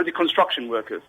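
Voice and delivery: normal talking voice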